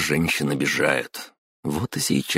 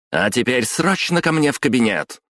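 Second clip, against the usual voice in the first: high, mildly raised command; raised-pitch boss's order; shouted order; 8 semitones higher than usual. A narrator's reading. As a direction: summon the staff urgently. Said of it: "крикнула Кимура".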